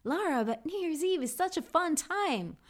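high, incredulous mock viewer; lightly voiced surprised viewer; imagined viewer. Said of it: "you're probably gonna be thinking".

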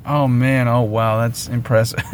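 low, dry mock praise of his own blunder; deadpan sarcasm at himself; sarcastic self-mockery. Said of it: "and I was like".

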